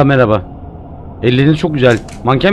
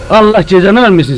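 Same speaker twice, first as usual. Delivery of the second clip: higher, louder curse yelled at a jumpscare; startled shout; 5 semitones higher, 5 dB louder than usual. Real, not acted. A player jumps at a scare and yells the curse at the game. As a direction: blurt it out after a fright. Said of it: "ben niye bağırdım ya?".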